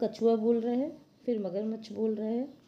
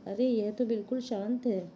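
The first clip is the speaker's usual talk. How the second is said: normal talking voice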